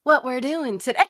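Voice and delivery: lightly voiced grandma, very slow and drawn out; a hint of a slow Southern belle grandma. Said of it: "she always talks like this".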